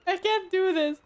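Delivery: laughing through tears; crying with laughter. Real, not acted. Streamer failing to mute the game, crying with laughter. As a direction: laugh till the tears come. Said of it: "now I'm crying".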